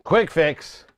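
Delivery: ordinary talk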